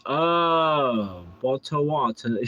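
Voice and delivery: lightly hammed British accent; "Boto Wata" with British vowels and dropped r; playful mock-British reading; mock British pronunciation. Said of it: "It's the way they pronounce it".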